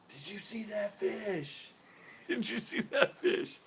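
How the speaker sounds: high, slow, soft incredulous squeal; awestruck imitation. Pro keeps turning to angler, awestruck disbelief. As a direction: stretch it out in mock disbelief. Pro repeatedly raving about the fish.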